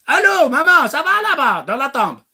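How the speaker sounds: high, loud fake-cheery call; hammed-up chirpy hello; mock phone call. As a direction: play a naive caller to the grave. Mock phone call to dead mother.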